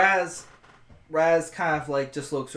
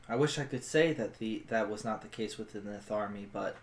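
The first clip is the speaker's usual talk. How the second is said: low, soft, reluctant admission; regretful soldier character; 7 semitones lower, 9 dB quieter, faster than usual. Acted. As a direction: speak softly and low, with regret. Player voices her character.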